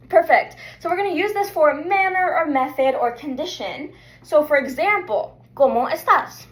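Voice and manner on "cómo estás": ordinary delivery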